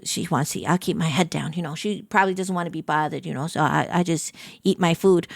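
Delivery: low, quiet self-talk; hushed inner thought. Retold or imitated. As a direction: murmur it to yourself, head down. Keeping head down near idol.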